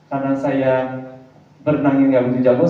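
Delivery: ordinary talk, nothing marked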